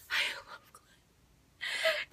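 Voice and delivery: whispered very high and very slow; breathy whispered confession. Real, not acted. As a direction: let it out as a giddy secret. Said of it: "But I love Glenn".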